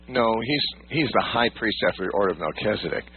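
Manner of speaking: normal talking voice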